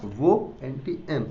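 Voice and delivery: वो NTM drawn out slowly; slowly, letter by letter; slow and spelled out. Shown as a demonstration. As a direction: stretch each letter of NTM. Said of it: "कौन सा?".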